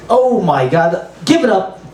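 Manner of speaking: ordinary talk, nothing marked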